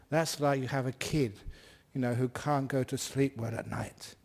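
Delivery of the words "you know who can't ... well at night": usual speaking voice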